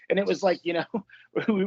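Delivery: usual speaking voice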